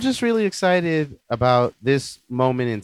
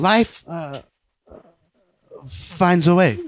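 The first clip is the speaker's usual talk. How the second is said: Jeff Goldblum impression; 4 semitones higher, much slower than usual. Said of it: "Gold-, what is it?".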